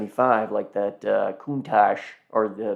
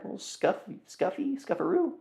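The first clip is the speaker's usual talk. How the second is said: silly high voice; 11 semitones higher, 9 dB quieter, faster than usual. Real, not acted. Playful silly word for scuffs.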